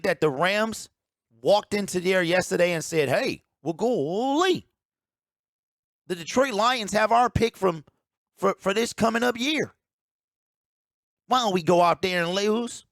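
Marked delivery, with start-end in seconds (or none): 3.1-12.9 hammed-up, higher-pitched country accent; mock country bumpkin accent. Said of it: "do a country accent".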